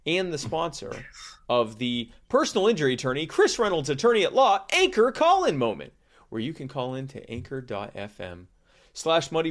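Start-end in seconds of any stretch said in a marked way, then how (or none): 0.1-5.9 loud, high booming announcer. Acted. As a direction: boom the title like a game show host. Sponsor segment title announced.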